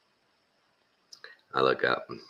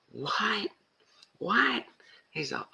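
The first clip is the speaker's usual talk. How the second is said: squeaky high mock shock; high-pitched incredulous impression; shocked disbelief; 12 semitones higher, slower than usual. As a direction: imitate stunned disbelief way up high. Retells comedian's shock at the news.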